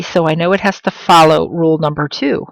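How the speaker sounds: ordinary talk, nothing marked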